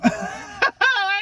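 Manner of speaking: high burst of laughter; laughing. Real, not acted. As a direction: crack up laughing at the mess. Laughs at the panties story.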